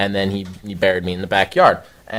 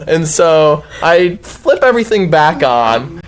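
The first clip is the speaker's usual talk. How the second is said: ordinary talk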